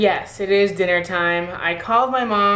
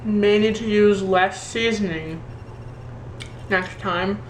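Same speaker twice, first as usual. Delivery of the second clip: talking with a mouth full of steak. Real, not acted. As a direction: speak around a mouthful of food. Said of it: "sorry for talking with my mouth full".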